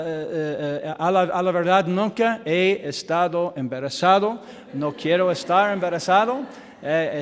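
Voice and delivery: ordinary talk, nothing marked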